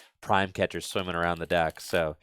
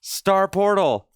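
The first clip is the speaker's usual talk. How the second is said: bad acting; 9 semitones higher than usual. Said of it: "Worst acting ever".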